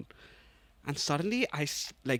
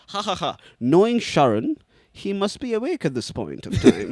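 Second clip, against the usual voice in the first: hearty fake laugh, then louder put-on voice; hammed-up, loud imitation of a friend; mocking friend impression; 2 semitones higher, 7 dB louder than usual. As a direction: mimic him as a booming uncle. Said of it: "he takes it a bit further".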